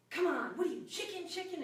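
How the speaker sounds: high, loud taunting mom; retold mother jeering chicken; mocking mother. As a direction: taunt him like a goading mother. Mother goading him on stairs.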